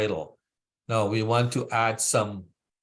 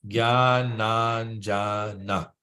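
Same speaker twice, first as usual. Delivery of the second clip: "Gyananjana" said very slowly, syllable by syllable; broken into syllables; much slower than usual. Shown as a demonstration. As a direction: spell out "Gyananjana" one syllable at a time. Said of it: "Break it down into syllables".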